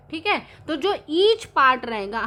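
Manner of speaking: usual speaking voice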